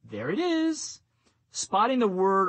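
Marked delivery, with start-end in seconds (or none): 0.0-1.0 light, high "There it is" said through a smile; cheerful high "There it is", quick and light. Shown as a demonstration. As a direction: pitch "There it is" well up, smiling as you spot it. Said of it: "smile, and say".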